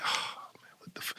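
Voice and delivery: whispered curse under the breath; muttering under breath. Retold or imitated. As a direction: grumble it quietly to yourself. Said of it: "you be like".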